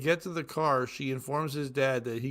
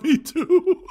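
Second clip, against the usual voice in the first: rising, louder punchline through laughter; cracking up; 12 semitones higher, 9 dB louder than usual. Real, not acted. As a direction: break into laughter as you read.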